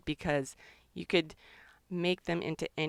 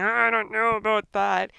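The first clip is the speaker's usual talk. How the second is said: voicing the birds; 2 semitones higher, 6 dB louder than usual. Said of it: "And they're saying".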